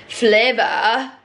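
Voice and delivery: "Flavour" said as "flivver", the a turned into a short i; imitating teacher's flavour. Home ec teacher saying flavour, imitated as "flivver". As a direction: mimic the teacher's "Flavour" with an i sound and a lift at the end. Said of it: "she'd say it like this".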